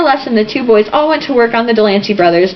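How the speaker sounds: ordinary delivery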